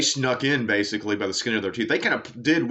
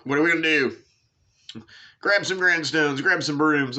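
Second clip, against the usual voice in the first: raised-pitch mock call to the gang; playful higher voice, rallying drinking buddies; drunken guys role-play; 6 semitones higher than usual. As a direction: lightly holler like a tipsy guy with a plan. Re-enacts the drunk guys again.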